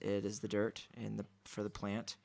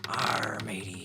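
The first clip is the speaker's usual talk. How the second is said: pirate voice; 5 dB louder, slower than usual. Pretend. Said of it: "sounds a little bit like a pirate ship".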